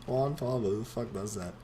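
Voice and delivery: soft, laughing repeat of the name. Real, not acted. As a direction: chuckle through the name.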